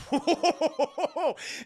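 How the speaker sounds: high, loud hooting laugh; mocking cackle. Agatha scoffing at the mayor's promise, mocking cackle. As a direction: cackle in his face.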